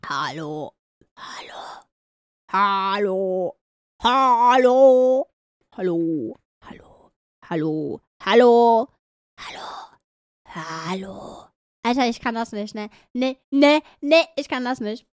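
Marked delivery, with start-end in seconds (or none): 0.1-11.5 extremely deep bass voice. Pretend. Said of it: "Ich versuch so tief zu kommen".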